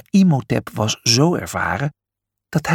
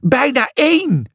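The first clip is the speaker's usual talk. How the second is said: higher, falling mock shriek on één; a lightly acted shrill answer; 11 semitones higher than usual. A narrator's reading. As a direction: state "Bijna één" flatly and high, falling on één. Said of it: "gilde Lucia".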